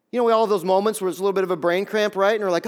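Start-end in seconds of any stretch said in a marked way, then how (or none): none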